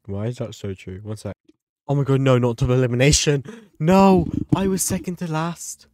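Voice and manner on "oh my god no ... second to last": loud, high protest; dismayed outcry. Real, not acted. Protesting the double elimination in dismay. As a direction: cry out against the result.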